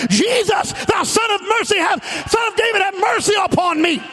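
high, shouted plea for mercy; crying out. Retold or imitated. Bartimaeus calling to Jesus for mercy, shouting high. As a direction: cry out to be heard over the crowd.

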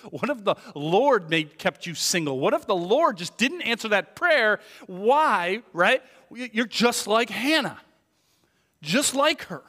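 loud, high, driving preacher's voice; fiery preaching. Acted. Preacher challenging the congregation on God's will, fervently. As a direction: press the questions hard on the congregation.

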